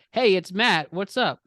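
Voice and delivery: sloppy tipsy hello; drunk voice. Retold or imitated. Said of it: "I drunkenly said".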